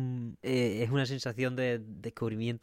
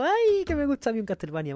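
gushing fan voice. Pretend. A Konami exec gushing over Castlevania, high swooning voice. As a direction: squeal it like a fan who adores Castlevania.

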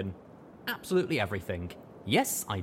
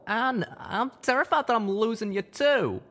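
high, louder breaking plea; brokenly; 14 semitones higher, 5 dB louder than usual. A narrator's reading. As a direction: voice cracking with fear of loss. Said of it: "Calamity said brokenly".

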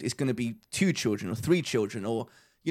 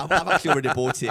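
louder, quick talk with real laughs; laughing; 5 dB louder, faster than usual. Real, not acted. Admitting to his friend he already bought the driver, laughing. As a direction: laugh while owning up.

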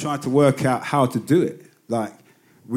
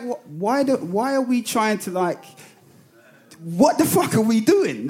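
bemused; 9 semitones higher, slower than usual. Retold or imitated. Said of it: "it was like".

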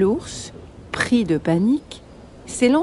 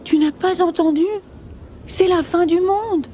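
high-pitched alarmed bunny voices; chorus of rabbits; 9 semitones higher than usual. Acted. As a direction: exclaim it high, as panicky rabbits. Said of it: "disent les lapins en chœur".

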